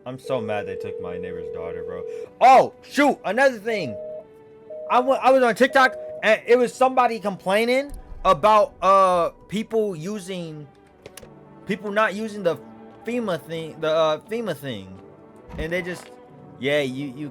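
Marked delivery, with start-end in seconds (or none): 2.4-13.4 loud, high, eager rush; excited. Real, not acted. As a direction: blurt it out excited. Sudden excited recall.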